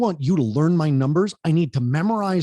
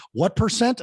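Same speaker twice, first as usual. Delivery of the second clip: higher call-out question to the crowd; prompting the crowd; 6 semitones higher than usual. Shown as a demonstration. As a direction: quiz the audience with a lift. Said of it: "I'm gonna say".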